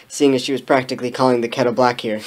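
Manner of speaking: plain reading voice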